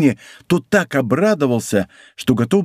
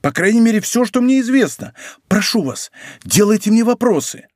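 high-pitched, urgent plea; anxious pleading; 8 semitones higher than usual. A narrator's reading. Bonacieux begging the commissioner for questions, anxious pleading. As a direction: beg to be questioned, pitch raised. Frightened prisoner pleads to answer.

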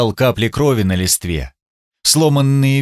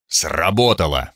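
grim satisfaction; 5 semitones lower than usual. Acted. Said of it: "с мрачным удовлетворением".

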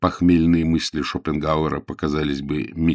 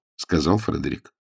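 ordinary delivery

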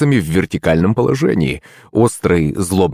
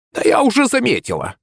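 put-on grumbling old witch; 14 semitones higher than usual. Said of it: "пробухтела старуха".

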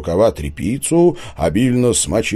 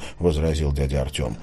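plain reading voice

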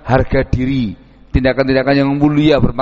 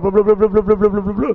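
mock quarrel babble; 7 semitones higher, faster than usual. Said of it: "ribut sama orang".

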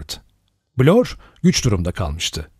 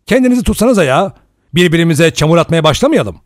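fast, much higher, louder outburst; bursting in; 6 semitones higher, 5 dB louder, faster than usual. A narrator's reading. Lombard bursts in to stop the quarrel, loud and fast. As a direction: cut in sharply to stop the fight. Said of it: "Philip Lombard atıldı".

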